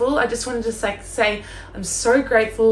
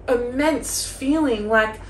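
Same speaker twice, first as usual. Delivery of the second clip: ordinary talk, nothing marked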